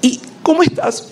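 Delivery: very high, soft gasp of a name; mom's cry of relief. Retold or imitated. Mother hearing her son's voice, high and overjoyed. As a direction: call his name in a high burst of relief.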